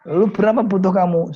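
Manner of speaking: high and loud, asking straight out; wealthy friend getting down to business; brisk question. Acted. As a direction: press him for the sum. Rich friend asks the amount.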